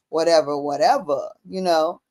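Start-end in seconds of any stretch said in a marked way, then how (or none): none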